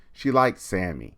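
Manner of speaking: ordinary delivery, nothing marked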